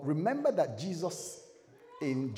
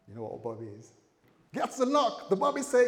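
ordinary talk, nothing marked

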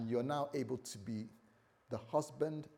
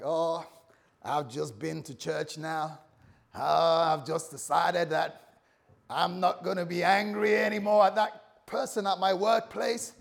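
loud, higher-pitched put-on American accent; mock American accent; 4 semitones higher, 7 dB louder than usual. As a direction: act out a fired-up churchgoer in a playful American accent. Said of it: "that's my American, um, accent".

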